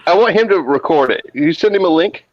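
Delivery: ordinary talk, nothing marked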